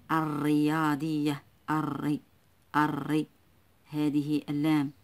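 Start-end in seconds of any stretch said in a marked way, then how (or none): 0.1-3.3 الرياضية slow, no l, doubled r; silent lam. Shown as a demonstration. Teacher showing the silent lam, الرياضية with doubled r. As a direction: roll straight into the r of الرياضية, then isolate الري. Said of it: "لا تنطق".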